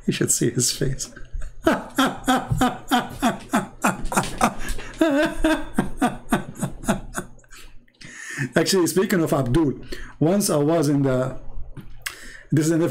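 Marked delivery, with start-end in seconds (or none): 1.1-7.2 high, wheezing, prolonged laughter; real, drawn-out giggling fit; long laugh. Real, not acted. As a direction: laugh helplessly at the memory. Laughs remembering the man's face.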